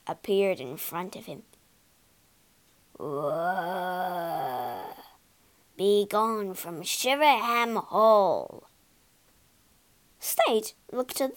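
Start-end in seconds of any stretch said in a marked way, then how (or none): 3.0-8.6 low, slow ghostly warning; haunt him with a slow spooky moan. Acted. Ghost frightening guest.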